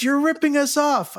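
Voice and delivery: the guy pressing his point, whiny and high; insistent drunk patron. Retold or imitated. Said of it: "He goes".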